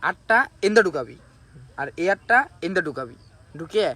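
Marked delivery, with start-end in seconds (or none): none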